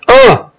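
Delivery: high startled "ugh" of fright; a sudden jump of disgust and fear, high-pitched; scared ugh. Retold or imitated. Boy recoiling at first sight of August, high startled ugh. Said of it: "I kind of went".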